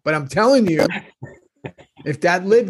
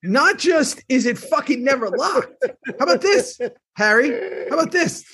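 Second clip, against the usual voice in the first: Salty Sal fired up; 7 semitones higher than usual. Pretend. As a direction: needle Harry like a cranky old man. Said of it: "Sal and Harry are out".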